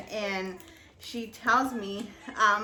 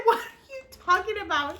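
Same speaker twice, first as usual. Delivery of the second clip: incredulous; 9 semitones higher, 4 dB louder, much faster than usual. Retold or imitated. Retold outraged reply.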